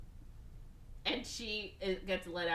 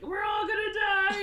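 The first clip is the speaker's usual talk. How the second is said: high, loud hammed-up panic; mock panicked shout; 14 semitones higher, 8 dB louder than usual. Pilot loses his calm mid-crisis, mock panicked shout. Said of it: "he doesn't stay calm".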